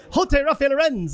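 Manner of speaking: imitating a Spanish accent to be understood; lightly put-on Spanish accent. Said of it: "I'll say it in a, in a Spanish accent".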